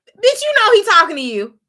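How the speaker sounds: high, loud hype shout; mock urging. Viewer yelling at Kip to go down, high loud urging. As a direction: egg him on loudly. Voicing Kip's inner push.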